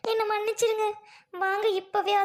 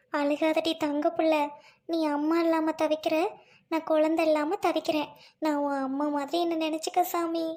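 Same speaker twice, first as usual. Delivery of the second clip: tender comfort; 3 semitones lower than usual. Acted. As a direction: soothe the orphan like her own. Childless wife comforting orphan. (அனாதைக் குழந்தையை அன்புடன் தேற்றும் தாய்)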